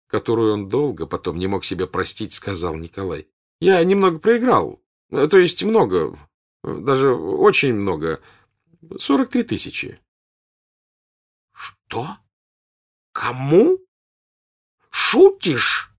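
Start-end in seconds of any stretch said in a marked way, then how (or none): 3.6-10.0 forced nonchalance. A narrator's reading. Son to father, confessing his debt with forced nonchalance. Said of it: "с глупою небрежной улыбкой". 11.5-15.9 high, loud, disbelieving cry; shocked shout. A narrator's reading. Old count to his son, shouting in shocked disbelief. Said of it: "крикнул граф".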